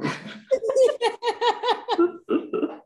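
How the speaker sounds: loud, high burst of laughter; high, loud cackling laugh; long high laugh. Real, not acted. Laughing at listener's remark.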